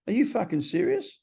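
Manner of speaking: high-pitched retold disbelief; high, incredulous question. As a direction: imitate a sponsor who can't believe it. Sponsor reacting in disbelief.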